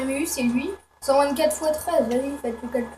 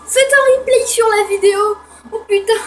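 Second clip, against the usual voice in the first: higher, louder through laughter; giddy laughing shout; laughing excitedly; 8 semitones higher, 9 dB louder than usual. Real, not acted. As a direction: talk while cracking up. Laughing at flying away.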